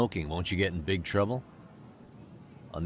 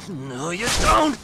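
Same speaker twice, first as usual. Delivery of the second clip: slow, much higher, louder retort; defiant shout; 9 semitones higher, 8 dB louder, slower than usual. Acted. Fighter shouting back at the gang leader. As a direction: drag it out as you dodge. Dodging the attack.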